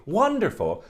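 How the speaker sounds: Wonderful cried high in delight, then dropping; delighted cry. Acted. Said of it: "she cried out".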